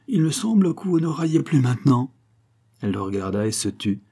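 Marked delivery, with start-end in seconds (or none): 0.1-2.1 higher, lightly sneering taunt; mocking. Don José taunts Concepción, mocking and higher. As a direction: gloat over her silence. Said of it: "d'un ton moqueur".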